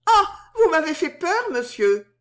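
high-pitched, startled soubrette; frightened little cry. A narrator's reading. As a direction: cry out in fright, pitch leaping up then falling. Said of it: "poussa un petit cri effrayé". (petit cri effrayé, voix aiguë)

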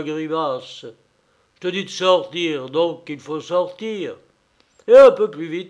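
loud, mock-fearful, then threatening; mocking masked bully. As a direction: tease then threaten them. Masked man threatens everyone. (brute masquée railleuse et menaçante)

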